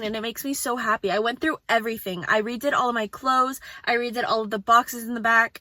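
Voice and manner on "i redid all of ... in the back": usual speaking voice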